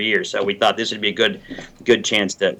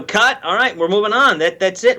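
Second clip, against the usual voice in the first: brisk director; 7 semitones higher than usual. Retold or imitated. Imitating the director on set.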